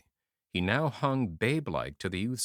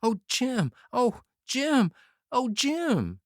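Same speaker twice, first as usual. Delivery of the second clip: high, raised anguished cries of a name; stammered lament; 13 semitones higher, 5 dB louder, slower than usual. Acted. Youth stammering over his dying friend, high anguished cries. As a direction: moan the name over and over, stricken. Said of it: "He stuttered and stammered".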